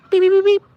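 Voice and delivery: squeaky high beep beep at the bird. Acted. Cooing at the bird Peaches, very high beeps. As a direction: chirp it to the chicken like a toy.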